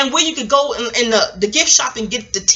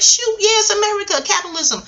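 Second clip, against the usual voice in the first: imitating stepmother; 10 semitones higher than usual. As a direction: play the shrugging stepmother, pitched way up. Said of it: "She was like".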